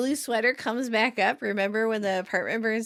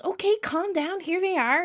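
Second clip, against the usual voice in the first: high-pitched mock appeasement; pacify the scolder in a high voice; placating; 8 semitones higher than usual. Rachel to a scolding Phoebe, placating.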